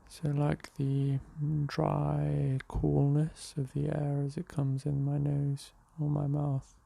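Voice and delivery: unhurried meditation voice with long pauses; guided meditation. Real, not acted. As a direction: guide them gently into the breath, leaving space between phrases. Leads a morning meditation.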